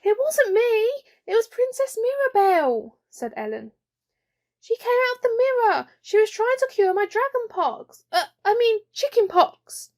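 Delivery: put-on high, eager child protesting; defensive little girl. Ellen protesting to her mum, high eager child. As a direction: talk fast to shift the blame.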